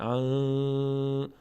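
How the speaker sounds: "ان" as a long, level nasal hum; nasal hidden nun. Shown as a demonstration. Teacher demonstrates ikhfa for learners, held nasal nun. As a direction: hold the N of "ان" through the nose.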